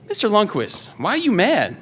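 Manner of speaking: light voicing of a curious girl; young girl asking. Twelve-year-old girl questions him.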